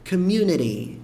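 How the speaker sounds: community with the T tapped like r, comiuneri; light final T. Shown as a demonstration. Teacher models the word for students, light tapped T. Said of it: "com esse som de T bem leve no final".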